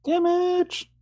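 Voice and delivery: gleeful shout. Pretend. Player shouts damage roll gleefully.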